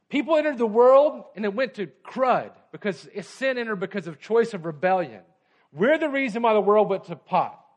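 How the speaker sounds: fast, loud, high sermon drive; full-force preaching. Acted. Preacher urging the church, fast and loud.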